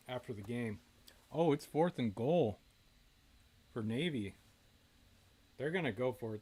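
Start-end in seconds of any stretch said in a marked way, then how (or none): 1.3-6.1 excited. Real, not acted. Sudden big moment in game.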